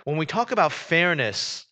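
ordinary talk

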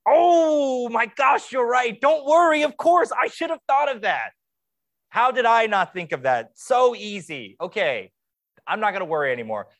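high, loud mock gratitude, dripping sarcasm; sarcastic gushing. Pastor mocking the easy advice, in a high, loud sarcastic gush. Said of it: "the more sinful part of my heart says".

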